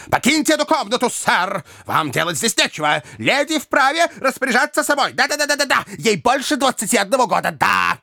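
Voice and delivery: high, rapid-fire defiance; cocky eloper shouting down his opponent; indignant rogue. A narrator's reading. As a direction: rattle out objections in a high voice. Jingle orders the gentleman out.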